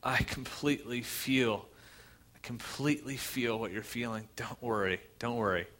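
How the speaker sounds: soft, tender reassurance; compassionate. Retold or imitated. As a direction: comfort them gently, feeling their pain. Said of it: "He says it with compassion".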